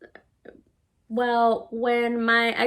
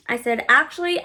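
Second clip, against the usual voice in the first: ordinary talk, nothing marked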